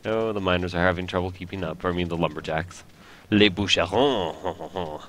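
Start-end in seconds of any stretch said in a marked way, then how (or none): 3.3-5.0 slow, carefully French "Les bûcherons"; French pronunciation. Shown as a demonstration. French Canadian gamer naming the lumberjacks, slow French accent. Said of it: "as we say in France".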